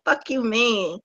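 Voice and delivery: ordinary delivery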